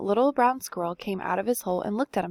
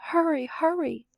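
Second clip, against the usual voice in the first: much higher, urgent call to the grandson; calling grandmother; 8 semitones higher than usual. Acted. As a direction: call him in fast, pitched up high. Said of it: "she called".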